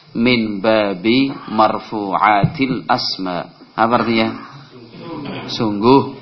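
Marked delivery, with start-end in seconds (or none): 0.1-3.6 slow recital. Shown as a demonstration. Teacher recites the lesson close.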